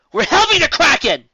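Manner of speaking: high, loud and final; loud resolute announcement; firm decision. A narrator's reading. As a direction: declare it with no room for argument. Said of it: "in a tone that broke no argument".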